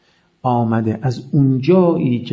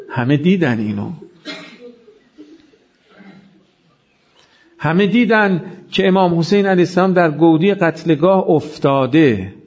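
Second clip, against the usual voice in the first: mourning lament preaching; 3 semitones higher than usual. Acted. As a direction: pour out the elegy to the gathered mourners. Mourning recitation builds to lament.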